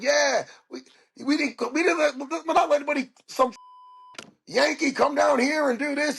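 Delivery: high, jeering heckler. Retold or imitated. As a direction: jeer down from the stands. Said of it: "heckling me in the stands".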